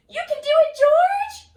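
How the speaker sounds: high, loud cheer with a cry in it; root for him on the hill; excited cheer. Acted. Reader cheering George down the hill, high and loud.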